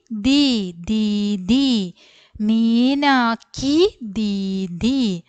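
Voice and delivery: normal talking voice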